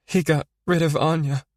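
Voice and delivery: high, cracking with tears; voice breaking. Acted. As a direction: let the voice break on the name. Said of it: "Her voice broke as she said the name".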